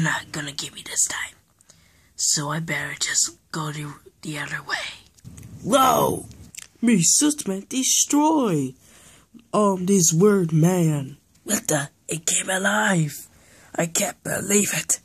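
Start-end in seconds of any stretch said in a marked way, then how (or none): 5.6-11.2 high, loud, slow caveman brute voice; brute suit monster. Acted. The living suit announces its attack in a loud, slow brute voice. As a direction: boom it out like a dumb monster coming to life.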